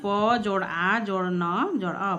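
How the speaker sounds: slow, syllable by syllable; slowly spelled out. Shown as a demonstration. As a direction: spell the word out slowly, sound by sound. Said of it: "वन विच्छेद करते हैं".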